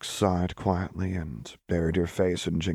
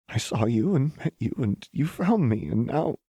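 higher, teary and moved; sniffling; 7 semitones higher than usual. A narrator's reading. Lux explaining to Jinx through sniffles. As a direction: speak through happy tears. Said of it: "Lux sniffled".

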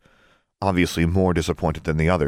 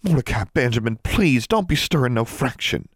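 higher, anxious plea; pleaded; 6 semitones higher than usual. A narrator's reading. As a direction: beg them to keep the peace. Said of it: "Forbearance pleaded".

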